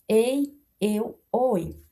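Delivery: "ei, eu, oi" with open vowels, éi, éu, ói; each of "ei, eu, oi" set apart, vowels open; open diphthongs. Shown as a demonstration. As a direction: list "ei, eu, oi" deliberately as open éi, éu, ói. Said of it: "ditongos abertos em monossílabos".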